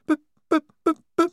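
put-on high, loud frantic stammering girl; frightened stammer. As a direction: stutter on the b in panic. Wazza stammering in distress.

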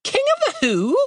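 very high British bird voice; squeaky-high bewildered question; puzzled talking bird. A narrator's reading. Bird puzzled by Chaz's title.

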